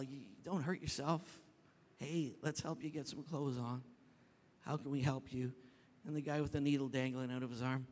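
soft, subdued kindly offer; gentle reassurance. Retold or imitated. Pastor offering water and help to people on street, gentle. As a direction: speak softly as to someone in trouble.

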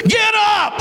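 high, sudden yelled order; shouted command. Acted. The Lord interrupting Joshua on his face, shouting. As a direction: cut off the wailing with a shout. God cuts off Joshua's wailing.

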